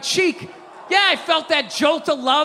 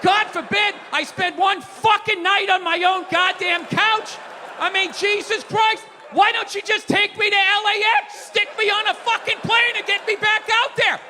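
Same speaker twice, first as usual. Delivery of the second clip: bitter shouted tirade; sarcastic angry rant; 7 semitones higher than usual. Retold or imitated. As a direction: rant like a fed-up husband on the road all year. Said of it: "the old me would have been like".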